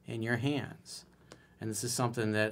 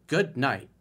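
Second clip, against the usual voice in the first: ordinary talk, nothing marked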